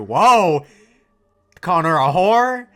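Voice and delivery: loud, high, drawn-out disbelief; shocked outcry. Real, not acted. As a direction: yell it, scandalized. Viewer shocked by the insult.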